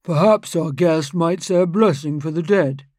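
a self-important lawyer making a suggestion; pompous lawyer. Acted. Lawyer proposes a blessing.

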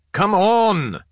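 loud, high impatient yell. Acted. Officer to navy adepts repairing the table, yelled. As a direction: yell it across the room to hurry them. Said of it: "Gaunt yelled at the Navy adepts".

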